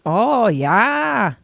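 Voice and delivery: put-on light Minnesota accent, drawn-out oh; a hint of folksy Minnesota, oh yeah. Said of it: "That's Minnesota".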